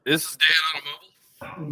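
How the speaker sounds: call-in show caller, through phone; phoning into a talk show; radio caller voice. Pretend. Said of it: "sounds like you're calling in".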